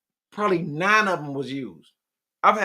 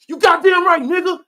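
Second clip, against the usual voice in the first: hammed-up yell, much higher and much louder; mock groom shout; 12 semitones higher, 9 dB louder than usual. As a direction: bellow it like an overeager groom at the altar. Mock groom answering at the altar.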